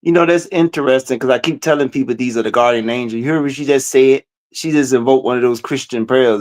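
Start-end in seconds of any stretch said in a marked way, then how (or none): none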